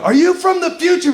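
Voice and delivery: mock-frantic question, high-pitched; mock urgent shout. Same prank, days later.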